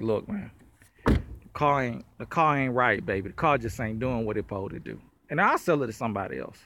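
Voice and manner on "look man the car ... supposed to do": low-pitched mock apology; letting down gently. Seller telling people the car ain't right, low and confiding. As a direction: break it to them man to man, low. Acted breaking bad news to buyer.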